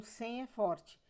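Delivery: ordinary talk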